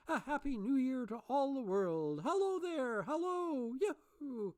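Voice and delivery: high, loud, whooping; giddy joy. A narrator's reading. Scrooge greeting the world, giddy whooping shouts. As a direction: shout with delirious delight.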